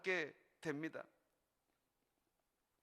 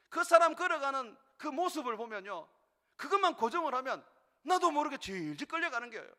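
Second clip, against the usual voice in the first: loud, driving sermon voice; fervent preaching; 5 semitones higher, 8 dB louder than usual. Acted. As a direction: push the exhortation hard to the congregation. Preaching at full force. (힘차게 외치는 설교)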